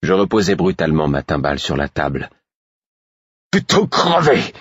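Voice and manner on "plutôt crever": howled. Acted. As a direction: scream the refusal. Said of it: "hurlai-je".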